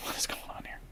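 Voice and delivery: whispered, unvoiced aside; a hushed what-is-happening mutter; bewildered whisper. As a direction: breathe it out in disbelief. His own puzzled inner reaction.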